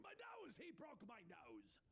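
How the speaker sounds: high, pained outcry; Penguin. A narrator's reading. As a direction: shriek in outrage, then sink.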